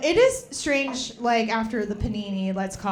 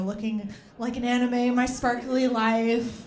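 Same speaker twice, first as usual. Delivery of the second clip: ordinary talk, nothing marked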